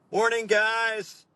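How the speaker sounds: high, slow mock American greeting; hammed-up, drawn-out American accent; ropey American accent. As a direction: put on a bad comedy mid-Atlantic accent. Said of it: "slightly ropey, like, mid-Atlantic accent".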